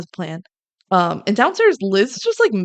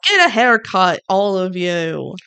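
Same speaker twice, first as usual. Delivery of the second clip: mock yell, louder at the start; retold yell; 4 dB louder, slower than usual. Liz yells at the guys in the car, mock yell. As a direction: imitate a yell, stretching out the ending. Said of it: "And Liz yells".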